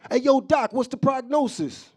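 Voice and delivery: high, loud street-style call to the doc; hammed-up loud question; acted patient. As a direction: holler it up high at the doctor. Patient calling the doctor, acted.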